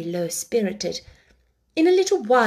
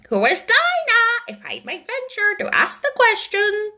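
high, loud, polite bird voice; a stiff little parrot asking; Lory bird; 12 semitones higher, 4 dB louder than usual. Acted. The Lory asking Alice about Dinah, in a high bird voice.

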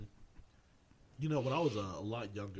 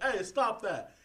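higher, louder aunt snapping at the dog; mock scolding aunt; 13 semitones higher, 6 dB louder, much slower than usual. Aunt coming down to stop the dog, with a mock scold. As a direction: call out sharply like an aunt breaking it up.

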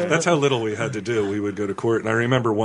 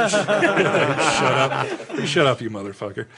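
laughing through it; 3 semitones higher, much slower than usual. Retold or imitated.

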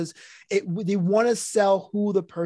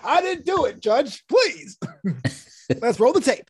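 much higher hyped plea to a judge; wrestling voice in court; 5 semitones higher than usual. Retold or imitated. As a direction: beg the judge in promo style. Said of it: "Especially in court or something".

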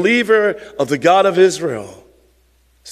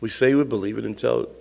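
soft, low pastoral prayer; 6 semitones lower, 5 dB quieter than usual. Real, not acted. Pastor leading the church in closing prayer, softly. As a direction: pray quietly and earnestly to the Father.

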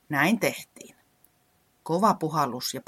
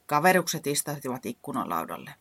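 ordinary delivery

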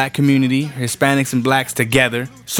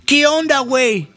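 much higher, mocking Mexican Spanish accent; mock Mexican Spanish greeting; 11 semitones higher than usual. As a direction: tease them with a high put-on Mexican Spanish greeting. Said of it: "they're like".

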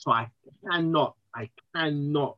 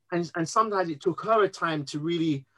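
normal talking voice

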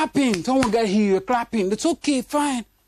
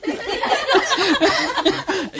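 laughing; 8 semitones higher than usual. Real, not acted. Laughs at own joke.

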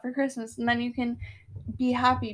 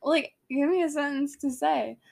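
usual speaking voice

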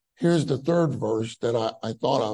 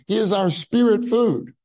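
spirit said high and loud; "spirit food" pressed out high and loud; stress on spirit food; 7 semitones higher, 5 dB louder than usual. Shown as a demonstration. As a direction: lift the word "spirit" hard. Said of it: "I would say it this way".

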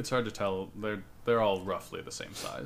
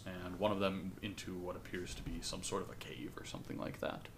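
whispering; 4 semitones lower, 9 dB quieter than usual. A narrator's reading. GM sets eerie scene.

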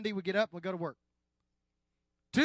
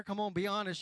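normal talking voice

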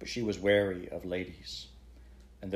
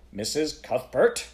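a sharp, louder, slow call of Missus Cuthbert; sharp rebuke; 6 semitones higher, 8 dB louder, slower than usual. A narrator's reading. Chairman to the interrupting woman, sharp rebuke. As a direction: cut her off firmly, higher and louder. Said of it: "The gentleman's voice was sharp".

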